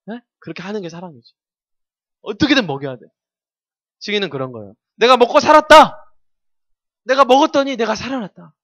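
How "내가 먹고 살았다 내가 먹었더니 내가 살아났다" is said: mock proclaiming, higher and louder; hammed-up witness shouting good news; triumphant proclamation.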